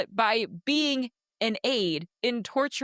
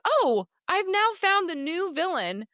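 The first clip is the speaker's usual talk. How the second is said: outraged realization; 10 semitones higher than usual. Retold or imitated. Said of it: "to me, I'm like".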